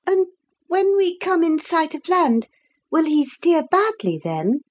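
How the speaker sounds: high, curious character voice; pitched well up, wanting to know; questioning Lucy. Acted. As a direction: ask it in a light, high voice. Lucy asks about steering.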